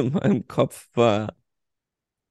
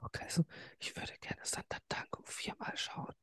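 hushed whisper; 19 dB quieter than usual. Real, not acted. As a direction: whisper so no one wakes up.

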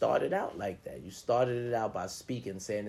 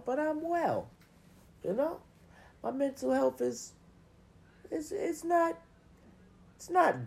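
high, forced chipper squeak; mock cheerful falsetto; squeaky fake cheer. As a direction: squeak it out like everything's fine.

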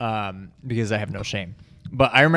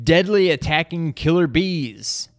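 dramatic trailer voice; 5 semitones higher, slower than usual. Acted. Reads game box blurb.

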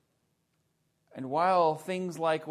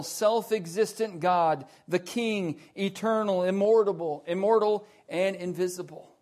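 normal talking voice

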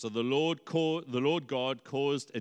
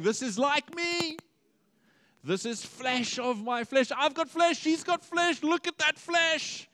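much higher, giddy wonder; delighted exclaiming; 12 semitones higher than usual. Acted. Adam gushing over Eve's flesh, much higher delight. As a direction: bubble over with delight at the likeness. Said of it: "He's saying".

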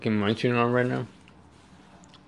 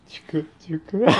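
words squeezed out while cracking up; laughing through words; 6 semitones higher, 4 dB quieter than usual. Real, not acted. Retelling the call to viewers, laughing through the words. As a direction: try to say it but keep laughing.

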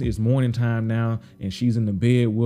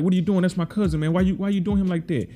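higher, heated mock protest; indignant confrontation; 7 semitones higher than usual. Trigg confronting police over his cousin, heated higher protest. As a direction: call out the cop for grabbing your cousin. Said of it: "Trigg comes out like".

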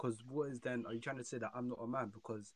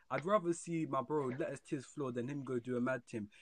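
ordinary talk

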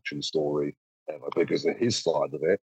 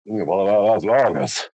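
louder, higher, hammed-up offense; mock offended logger; 4 semitones higher, 4 dB louder than usual. Shown as a demonstration. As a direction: play the wounded logger. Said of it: "second take of the logger line".

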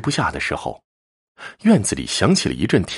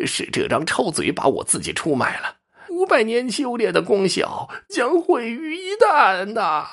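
desperate hoarse wail. A narrator's reading. Doomed fox spirit losing centuries of cultivation, wailing desperately. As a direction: wail it out, stretching the final words. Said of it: "声嘶力竭地说".

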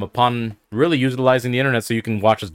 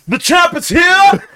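high, slow shouted boast; loud proud boast; 5 dB louder, slower than usual. Acted. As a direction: bellow it like a champ. King's triumphant boast.

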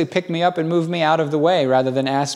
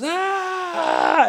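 sudden high yelp of pain; a short shocked cry, pitched very high; scream. Retold or imitated. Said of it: "and he screams".

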